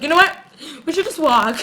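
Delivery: high, jumpy imitation with a laugh; mock scared. Vlogger imitating him backing out of the car, mock scared. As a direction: blurt a nervous excuse, hammed-up. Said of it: "He looks like he's scared".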